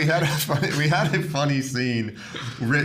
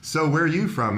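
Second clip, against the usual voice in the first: usual speaking voice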